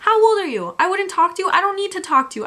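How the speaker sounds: put-on how old are you, fed up and incredulous; indignant outburst.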